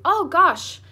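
high, loud burst of dismay; dismayed gasp. Acted. Peter hearing Edmund's betrayal, loud dismayed gasp. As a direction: blurt it out in shock.

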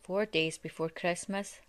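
ordinary delivery, nothing marked

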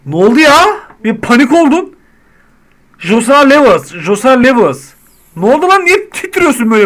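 high, loud alarmed shouting; panicked at the twitching customer; startled panic. Real, not acted. Banker shouting at a twitching customer, alarmed.

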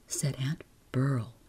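ordinary delivery, nothing marked

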